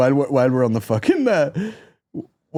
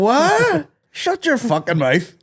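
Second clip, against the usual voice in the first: high, incredulous, then slower put-down; mock indignant retort; 13 semitones higher, much slower than usual. Wedding guest answering teasing Cork visitors, mock indignant. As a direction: snap back in exaggerated disbelief. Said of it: "And you're like".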